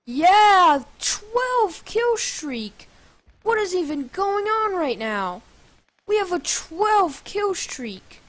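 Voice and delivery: high, drawn-out disbelief; stretched-out, high-pitched amazement; amazed excitement. Real, not acted. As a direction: marvel at your own winning streak. Amazed at own kill streak.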